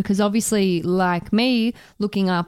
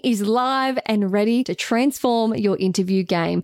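upbeat slow promo voice; ad read; 4 semitones higher than usual. Acted. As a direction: sell it like a radio ad. Ad read for their course.

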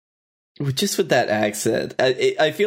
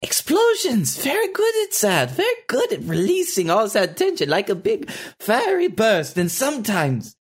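high, far-off German villain voice doing a gentle character; lightly comic German accent, pitched way up; Valgor's German accent; 9 semitones higher than usual. Acted. Voice actor slipping into the German Valgor voice for the host. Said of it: "the German accent usually is for an evil person".

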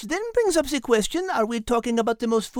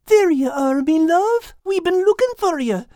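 louder lilting lass voice; a warm brogue-tinged lass; cheerful Kate; 5 dB louder than usual. Acted.

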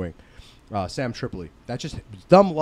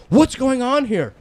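stern security guard; 10 semitones higher, 4 dB louder, slower than usual. Retold or imitated. Security confronting the group.

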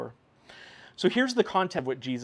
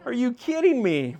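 loud, higher, slow disbelief; incredulous angels; 9 semitones higher, 10 dB louder, slower than usual. Retold or imitated. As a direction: stretch it out in shocked disbelief. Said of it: "They're just like".

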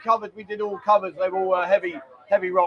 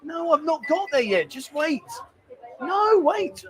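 higher-pitched, impatient protest; exasperated; 7 semitones higher, slower than usual. Real, not acted. Host to interrupting partner who rushes him, exasperated. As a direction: protest in a raised pitch at being hurried.

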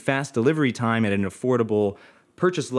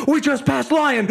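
high, drawn-out mock cry; slow, pitched-up crowing; excited announcement; 12 semitones higher, 3 dB louder than usual. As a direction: imitate a thrilled lookout reporting. Teammate announcing progress, acted.

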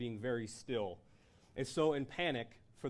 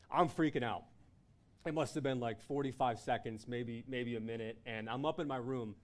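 usual speaking voice

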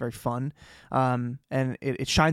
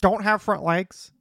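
high, slow know-it-all correction; mock pedant; 6 semitones higher, slower than usual. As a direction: correct them like a pedantic nerd, drawn out. Mock pedantic correction about wyverns.

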